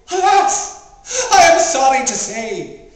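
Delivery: high, loud tragic cry; theatrical lament. Acted. Reciter mourning the bridge disaster to an audience, hammed-up lament. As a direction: wail it out for the back row.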